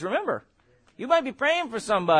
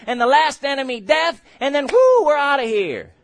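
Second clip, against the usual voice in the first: louder, higher triumphant build; exultant; 5 semitones higher, 6 dB louder than usual. Real, not acted. Preacher building to the rapture, louder and higher. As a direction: rise to a shout of victory.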